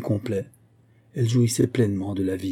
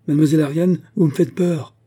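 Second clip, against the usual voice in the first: raised, high-pitched worried call; alarmed appeal; 7 semitones higher, 6 dB louder than usual. A narrator's reading. Ladov to the young woman at the edge, alarmed appeal.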